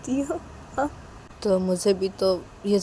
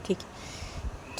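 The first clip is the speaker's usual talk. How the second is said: whispered okay; 12 dB quieter, much slower than usual. Acted. Riya answers distractedly.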